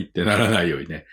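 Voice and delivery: ordinary delivery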